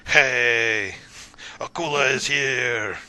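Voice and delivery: loud, drawn-out hail; calling out to a crowd. Acted. Fighter shows off to the cheering crowd, loud proud call. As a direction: project big, stretching the words.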